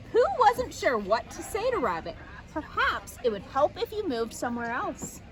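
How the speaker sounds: usual speaking voice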